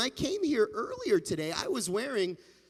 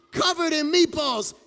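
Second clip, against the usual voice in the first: plain reading voice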